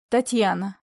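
ordinary delivery

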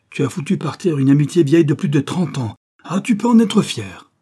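plus fort et plus aigu, amer, finit en éclat sarcastique; colère blessée. Acted. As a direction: reproche furieux à l'ami qui l'a trahi. Ami trahi, rupture d'amitié. (Léopold furieux contre Louis, colère amère)

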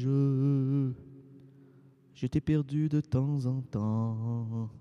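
slow, measured poetic reading; poem recitation. Acted. Récite un poème chanté. (récitation poétique lente)

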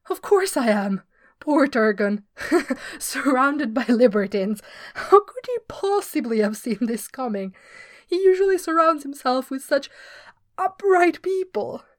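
laughing through words. Acted. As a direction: try to deny it while laughing. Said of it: "dissolved into helpless laughter".

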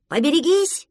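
high, loud warning command. A narrator's reading. Duckling commands his crewmate in play, loud. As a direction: order it out like a boat captain. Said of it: "скомандовал утёнок".

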